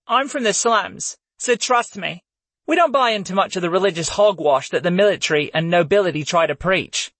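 lightly voiced slum girl; Layla's voice. A narrator's reading.

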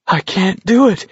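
high, winded protest; gasping. A narrator's reading. Garrity protests to McVries, gasping for wind. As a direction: plead it breathlessly, pitched well up. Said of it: "Garrity gasped".

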